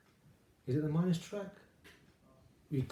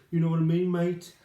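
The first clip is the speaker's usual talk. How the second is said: a quick, lightly hammed Birmingham accent, higher and louder; 4 semitones higher, 6 dB louder than usual. Host mocks a Birmingham accent to his guest, lightly hammed Brummie. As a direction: toss off a jokey Brummie "mate" in a Birmingham accent. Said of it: "she hasn't got a Birmingham accent".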